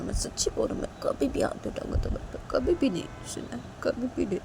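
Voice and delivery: soft, earnest vow; hushed, contrite insistence; reassuring Disha. Acted. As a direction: promise gently, over and over.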